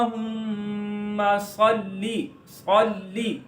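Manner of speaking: अल्लाहुम्मा slow with long hummed mm, then heavy सल्ली; ghunna and pause. Shown as a demonstration. Teacher repeats the rules in recitation, ghunna and pause. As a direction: stretch the aa, hum the meem, dwell on the l of सल्ली. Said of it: "मीम पर गुन्ना करेंगे".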